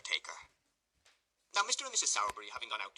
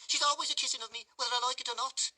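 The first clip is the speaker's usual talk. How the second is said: much higher, louder whining blubber; 5 dB louder than usual. A narrator's reading. Noah blaming Charlotte to the beadle, blubbering. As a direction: whine and snivel the blame onto her. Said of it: "said Noah, blubbering".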